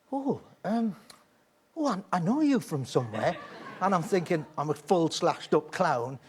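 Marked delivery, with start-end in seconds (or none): 0.1-3.4 put-on higher, light old-lady voice; old woman voice. Puzzled old woman peering at the clown, lightly voiced. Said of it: "and she went".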